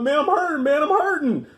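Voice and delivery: high, whiny mock lament; hammed-up heartbroken moan; mock hurting. Calling brother, retold heartbreak.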